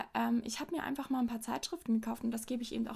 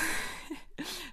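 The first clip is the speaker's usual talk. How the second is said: hohes, leises Auflachen; kurzes überraschtes Lachen; 6 semitones higher than usual. Real, not acted. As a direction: ein kleines Lachen der Überraschung. Lacht über eigene Entdeckung. (überraschtes Auflachen)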